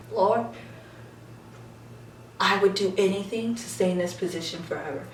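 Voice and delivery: soft, low confession to oneself; swooning aside. Retold or imitated. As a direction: murmur it like a secret prayer. Private swooning aside among the idols.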